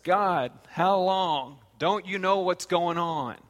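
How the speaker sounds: loud, slow, drawn-out complaint; Habakkuk pleading. Retold or imitated. Habakkuk complaining to God, a loud and drawn-out plea. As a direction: stretch the how long in frustration. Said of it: "Habakkuk says".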